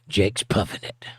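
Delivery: ordinary talk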